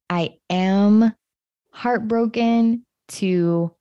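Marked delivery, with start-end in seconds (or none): none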